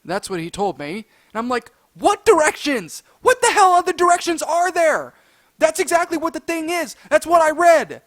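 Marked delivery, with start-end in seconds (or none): 1.9-8.0 loud, high, incredulous retort; retold outraged protest; indignant arguing. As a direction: throw the accusation back loudly. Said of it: "trying to argue and reason".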